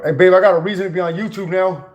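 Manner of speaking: thrilled shout to his partner; excited call out. Real, not acted. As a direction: call out your news to babe.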